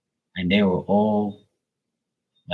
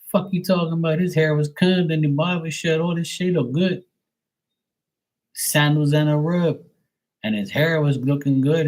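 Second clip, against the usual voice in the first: heated mock rant; 7 semitones higher than usual. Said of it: "I said".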